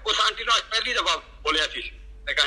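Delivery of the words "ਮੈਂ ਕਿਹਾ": ordinary talk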